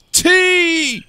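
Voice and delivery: high, loud, held cry; storybook squeal of alarm; startled shriek. A narrator's reading. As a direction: break off in a sudden shriek. Startled character shrieks, drops egg.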